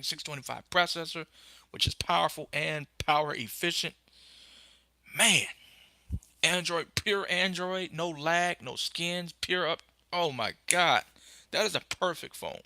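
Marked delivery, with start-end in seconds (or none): 5.2-12.6 gushing excitement. Real, not acted. Gushing over the perfect phone.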